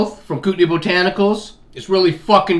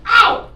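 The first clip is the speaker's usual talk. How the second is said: sudden high yelp of pain, loud; sharp pained cry; 7 dB louder than usual. Real, not acted. Hitting himself on the table leg, a loud high yelp. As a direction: yelp like you just whacked yourself.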